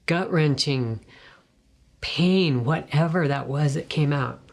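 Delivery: ordinary delivery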